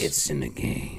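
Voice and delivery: deep slow slogan voice; deep announcer voice. Retold or imitated. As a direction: drop low like the game intro. Imitating EA Sports slogan.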